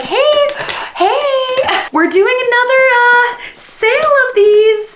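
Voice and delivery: high, sing-out sales pitch; Illumicrate. Retold or imitated. Vlogger imitating a book company announcing a sale, high-pitched. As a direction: put on a high, coaxing caller's voice. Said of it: "Illumicrate was like".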